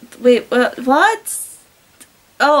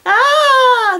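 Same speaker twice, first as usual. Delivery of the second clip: high, loud hammed-up cry; loud moan; 12 semitones higher, 5 dB louder than usual. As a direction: cry out, overdone.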